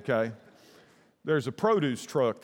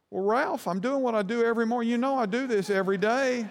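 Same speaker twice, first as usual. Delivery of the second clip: a higher, faster, matter-of-fact voice; produce guy; 9 semitones higher than usual. Retold or imitated. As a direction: play an unbothered delivery man. Produce guy answers the cop.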